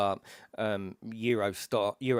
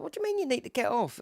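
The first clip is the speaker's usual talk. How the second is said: very high, quick incredulous question; retold disbelief, pitched way up; faster than usual. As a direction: imitate a baffled driver. Driver's astonished reply, acted.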